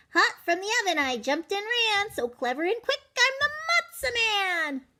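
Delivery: high, loud sing-song boast; boastful matzo man. Acted. Matzo man boasting to the goat, high sing-song. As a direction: chant the rhyme proudly like a cocky little cookie.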